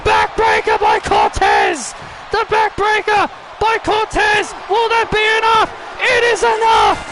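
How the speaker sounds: excited play-by-play. Real, not acted. Commentator calling the winning backbreaker, excited shout. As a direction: call the finish at full voice. Live match finish call.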